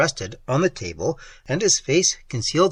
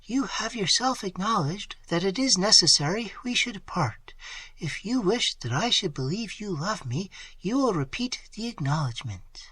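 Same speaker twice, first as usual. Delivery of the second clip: high, soft, gentle voice; calm him down softly; soothing; 6 semitones higher, 4 dB quieter than usual. A narrator's reading. A frightened young woman soothing her lover, soft and high. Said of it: "in a soothing voice".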